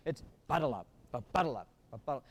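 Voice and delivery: "ba-da-la" sung out with weight on the opening "ba"; stress on the first note. Shown as a demonstration. Band director showing which note gets weight, first-syllable stress. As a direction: lean on the first syllable of each "ba-da-la". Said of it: "First one, I, I agree".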